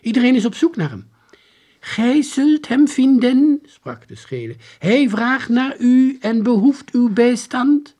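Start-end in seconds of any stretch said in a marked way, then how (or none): 0.0-1.1 high, loud and fast; rushed, raised girl's voice; urgent exclamation. A narrator's reading. Monica urgently questions the soldier. 1.8-3.6 high, loud, slow declamation; stiff, drawn-out proclamation; strange soldier. A narrator's reading. 4.8-7.9 high, loud, slow declamation; stiff, drawn-out proclamation; strange soldier. A narrator's reading.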